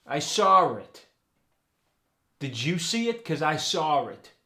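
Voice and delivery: SAW IT said "sawr it", R thrown in; New York accent, add an R between saw and it; intrusive R. Shown as a demonstration. Coach showing New York saw it with an added R. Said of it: "Sometimes an R gets thrown into a word".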